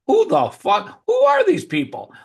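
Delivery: high, loud incredulous outburst; mock outraged yell; retold outrage. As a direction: blow up in disbelief. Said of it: "And I'm like".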